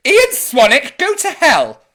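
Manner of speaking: a loud mock-angry outburst at a commenter, half laughing. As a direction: bark it out in playful fury. Joking insult to a commenter.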